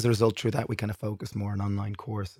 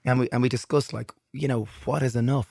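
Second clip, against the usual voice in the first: ordinary delivery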